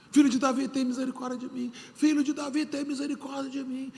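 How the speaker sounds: high, pleading cry to Jesus; crying out for mercy. Retold or imitated. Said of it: "Gritou, gritou, gritou, gritou".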